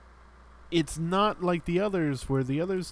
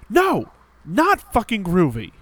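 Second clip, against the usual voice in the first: loud, high-pitched imitated refusal; mock outraged shout; 6 semitones higher, 10 dB louder than usual. Hero rejecting the villain's catchphrase, mock outraged shout. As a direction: yell it back like an exasperated game hero. Retelling hero's outraged comeback.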